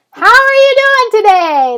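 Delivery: high, loud, bubbly greeting through the speaker; cheerful drive-thru voice. Retold or imitated. Drive-thru lady greets the customer through the mic, high and bubbly. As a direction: imitate the happy Taco Bell lady over the mic. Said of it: "That's the voice that comes out of the mic".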